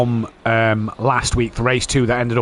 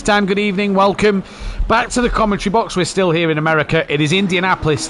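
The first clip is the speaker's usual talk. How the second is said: higher, bright broadcast host opening; commentator intro; 6 semitones higher than usual. Acted. Host welcoming viewers to the race, higher broadcast announcer voice.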